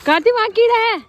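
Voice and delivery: high, loud call across the yard. Real, not acted. Calling out to child outdoors.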